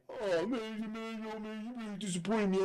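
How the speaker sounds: hammed-up disappointed moan; mock hurt. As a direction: whine it small and high, making fun of him. Robbed man's hurt complaint, imitated.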